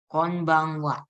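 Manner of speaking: slow, drawn-out "Konbanwa" with a long final waa; a slow try at saying "Konbanwa" right; careful Japanese konbanwa. Shown as a demonstration. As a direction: sound out "Konbanwa" very slowly, syllable by syllable. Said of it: "Coba ya".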